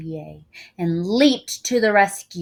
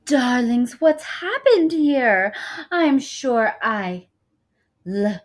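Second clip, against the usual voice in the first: high, loud theatrical diva; grand diva; 7 semitones higher, 11 dB louder, slower than usual. A narrator's reading. Film star calling out to the crowd, theatrical diva voice. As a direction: sweep in like a stage star. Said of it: "she called out suddenly".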